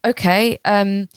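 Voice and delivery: ordinary talk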